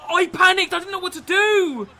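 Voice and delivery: loud, high frantic shout; shouting at himself after a miss; panicked yell. Real, not acted. Gamer yelling after fluffing chance.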